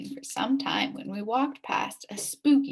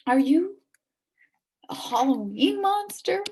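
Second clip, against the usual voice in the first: trembling question; 6 semitones higher, 4 dB louder than usual. Acted. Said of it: "I asked, trembling".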